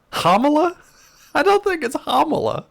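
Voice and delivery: hammed-up disbelief at chat's pronunciation; incredulous. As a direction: play up disbelief at the silly name. Testing chat's absurd pronunciation.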